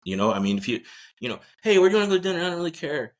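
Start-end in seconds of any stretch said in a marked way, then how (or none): 1.6-3.1 lightly acted, higher-pitched breezy ask; play-acted couple small talk; acted casual question. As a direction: toss it out loud, then casually easing off. Mock couple's dinner exchange.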